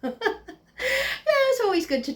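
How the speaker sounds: breaking into laughter; laughing. Real, not acted. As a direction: laugh through the words. Laughing through the line.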